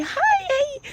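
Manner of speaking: very high, mocking greeting; high-pitched mock hi; imitated woman. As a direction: squeal a little hello in falsetto. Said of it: "when they say".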